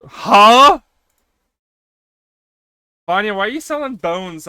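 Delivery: loud, high, rising yelp; shocked question. Real, not acted. Streamer hearing bones are being sold, loud shock. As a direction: blurt it out loudly in disbelief.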